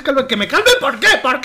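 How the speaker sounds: mock exasperation. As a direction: protesta burlona ante el "cálmate". Mocking the advice to calm down. (exasperación fingida, más alta)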